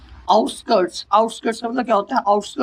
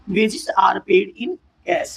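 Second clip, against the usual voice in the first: very slow, word by word; teacher dictating slowly; slow example read; much slower than usual. Shown as a demonstration. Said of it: "example देखिए".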